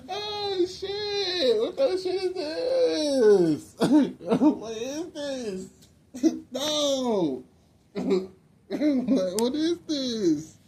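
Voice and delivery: high, drawn-out wail through laughter; laughing mock wail. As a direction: stretch it out high, half laughing, half crying. Hammed-up reaction through laughter.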